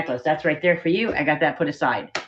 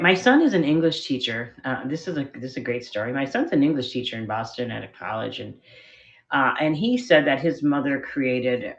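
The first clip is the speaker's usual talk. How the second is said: ordinary talk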